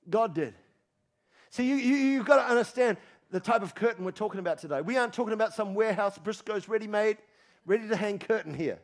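ordinary delivery

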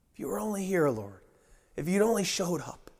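soft, deflated plea after the outburst; acted subdued reproach. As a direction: let the acted lament sink quietly. Grieving plea dies down.

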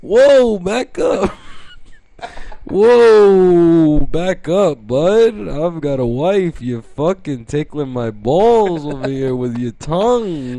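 loud drawn-out mock-Italian warning; slow, indignant Italian-guy protest; Italian road rage. Italian driver warning someone to back off, hammed-up accented rage. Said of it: "an Italian guy who's having road rage".

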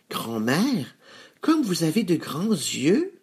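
put-on high little-girl exclamation; high, wondering child; exclaiming little girl. A narrator's reading. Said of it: "s'exclama-t-elle".

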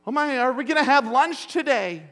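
put-on high, loud, panicky child's plea; mock panicked kid. Preacher imitating his kids freaking out, high and panicky. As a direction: play the frantic kid who fears no lunch. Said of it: "if they were freaking out like".

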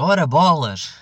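loud, prim little curse; a sweet-mouthed ora bolas, a touch loud; polite mock oath; mock exclamation. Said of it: "e disse logo".

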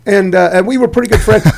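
ordinary delivery

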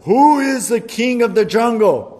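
loud, high roaring challenge; lion roaring at the turtle. A narrator's reading. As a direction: roar it out as the proud lion. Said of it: "the lion roared".

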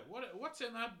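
usual speaking voice

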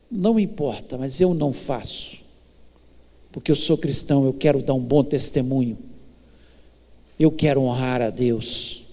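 low-pitched, measured reply; calm, firm refusal. Acted. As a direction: stand your ground quietly, dropping the pitch well down.

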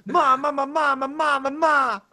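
very high babbling of ma; baby babble. Acted.

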